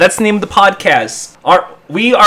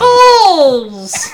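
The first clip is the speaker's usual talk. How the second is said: much higher, drawn-out silly cry; funny voice; 13 semitones higher, 4 dB louder than usual. Acted. Co-host retrying the catchphrase in a funny voice, exaggerated. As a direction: push it far above your regular voice. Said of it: "I said a funny voice, not your regular voice".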